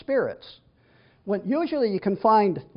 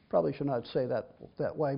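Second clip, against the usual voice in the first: softer, half to himself; sheepish aside; 5 semitones lower, 8 dB quieter than usual. Real, not acted. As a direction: drop to a muttered self-correction. Sheepish aside about another pastor.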